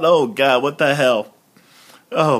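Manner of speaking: ordinary delivery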